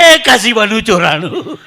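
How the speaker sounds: loud, laughing punchline delivery. Shown as a demonstration. Comedian repeating the pun to the laughing crowd. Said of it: "repeats punchline".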